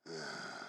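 low, soft growling sigh; a father's low growl at his son; angry growl. Retold or imitated. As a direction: growl it under your breath like a furious father. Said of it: "He growled at me".

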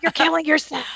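high scolding crone voice; old hag voice. Pretend. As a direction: nag them like an old hag. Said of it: "someone dressed up like an old hag going".

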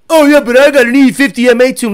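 loud, put-on car-guy boast; mock bragging owner. Tuner mocking a customer's brag, loud mock boast.

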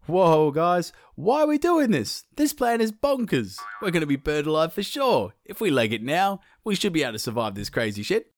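panicky, pitched high, wanting to run; a nervous brother begging the others to flee. Acted. Nervous brother urging his brothers to run away, voice pitched high. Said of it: "started to get nervous and wanted to scoot".

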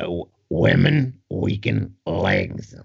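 put-on slow, low grouchy old trainer; Mick. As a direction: drag it out low like a grouchy old boxing coach. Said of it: "can he do a better Mick than you".

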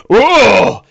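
loud, high startled whoa; a big booming cry of surprise; ogre's startled whoa. Acted. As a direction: ogre caught by radiant fire. GM voices ogre blocking flame.

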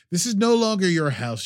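hammy stern dismissal; dramatic scolding. Acted. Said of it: "way over dramatic".

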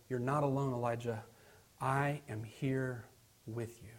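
hushed, tender voice of God; still small voice. Acted. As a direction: comfort him quietly, fading to very soft. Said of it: "in a still small voice".

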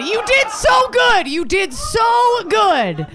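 high, slow cheering shout; mock cheer. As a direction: cheer them on like a proud coach.

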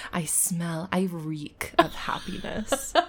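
slow, savoring mock yoga girl. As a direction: draw it out as a self-satisfied yoga girl. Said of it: "little blonde girls that do yoga that are like".

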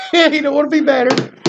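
high, laughing repeat of the line. Retold or imitated. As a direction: crack up while saying it.

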